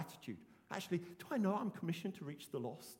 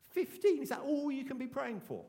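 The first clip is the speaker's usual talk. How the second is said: a much higher, louder scoff at "Fifteen"; mock scoff; 8 semitones higher, 6 dB louder than usual. A congregant mocking the target as too small, scoffing. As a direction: sneer at the small number as a scornful doubter would. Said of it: "Some of you may be going".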